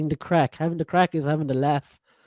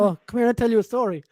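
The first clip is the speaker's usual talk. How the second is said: playful Irish; 7 semitones higher, 3 dB louder than usual. Retold or imitated. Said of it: "used around our way".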